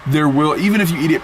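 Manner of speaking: high, animated protest; rising, insistent objection; excited. Real, not acted. Excited argument about crumbs.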